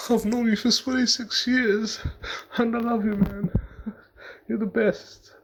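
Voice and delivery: high-pitched mock-tearful proposal; mock crying. Man proposing on his knees to his girlfriend, mock crying. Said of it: "All crying and that".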